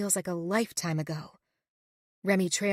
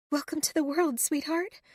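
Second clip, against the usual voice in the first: tender; 7 semitones higher than usual. A narrator's reading. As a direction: coo to a newborn. Mother greets newborn softly.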